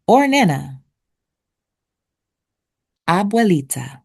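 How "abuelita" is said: Abuelita said the Spanish way, ah-bweh-LEE-tah; Abuelita, clear Spanish sounds; Spanish pronunciation. Shown as a demonstration. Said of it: "Abuelita is a Spanish word".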